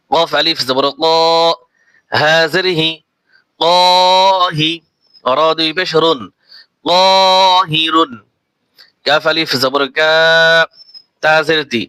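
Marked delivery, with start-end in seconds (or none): 3.6-8.1 very slow, long stretched vowel; slow Quranic spelling blend; drawn-out blend. Shown as a demonstration. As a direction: stretch the alif one beat. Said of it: "এক আলিফ টান দিয়ে".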